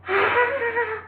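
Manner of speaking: put-on high fussy child cry sound; child's whine. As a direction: imitate a frustrated toddler's wail. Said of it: "doing like".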